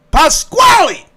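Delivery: high, loud shout of a name; imitated shrieking summons; calling out. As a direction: holler for him, stretching the second call.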